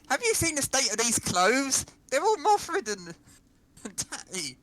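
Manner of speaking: Poppy impersonation. Pretend. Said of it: "a bad but attempted, uh, accent".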